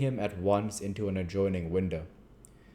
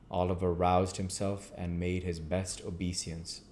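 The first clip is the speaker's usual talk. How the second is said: usual speaking voice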